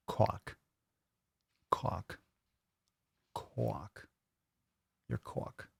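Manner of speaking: fading to whisper. Pretend. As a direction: trail the word off into a whisper. Repeating word, fading out.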